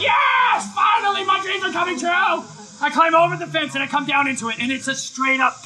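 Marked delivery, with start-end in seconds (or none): 0.0-2.4 dreams finally coming true; triumphant whoop; thrilled yell. Retold or imitated. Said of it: "and I'm like".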